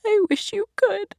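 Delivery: a breaking, high tearful plea. Acted. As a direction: let the voice crack as it climbs. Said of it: "her voice breaking".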